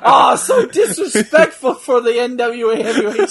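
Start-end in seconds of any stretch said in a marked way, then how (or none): 0.0-1.8 laughing. Real, not acted. Laughing at the headbutt on his own man.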